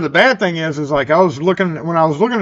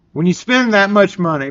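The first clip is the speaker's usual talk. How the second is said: ordinary talk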